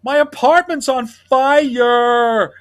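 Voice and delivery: put-on high, loud, slow childish wail; Stinky's slow wail. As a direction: stretch it like a whiny overgrown kid. Stinky's punchline, drawn out.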